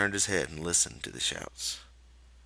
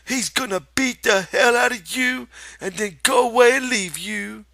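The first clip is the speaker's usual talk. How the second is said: high, loud, mocking rabbit shout; rabbit shouting at Lenny; 10 dB louder than usual. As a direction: hammer him with a shrill scolding rabbit voice. Rabbit taunting.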